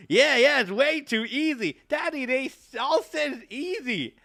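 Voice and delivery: a hint of a bouncy little girl; eager child.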